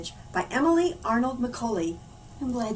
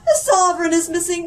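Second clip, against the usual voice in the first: loud, much higher alarmed call; 8 semitones higher, 9 dB louder than usual. Acted. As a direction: raise the alarm. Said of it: "called the sheriff".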